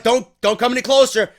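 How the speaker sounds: hammed-up menacing shout; mock threat. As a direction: yell a hostage threat at the approaching giant. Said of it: "Big Cass was like".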